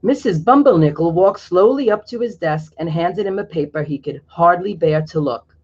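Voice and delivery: ordinary delivery, nothing marked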